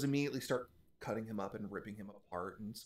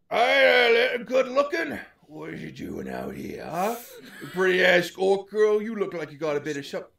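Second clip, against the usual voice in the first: hobgoblin voice; 8 semitones higher, 11 dB louder than usual. Acted.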